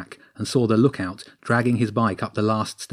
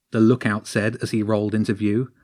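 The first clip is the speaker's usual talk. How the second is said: ordinary delivery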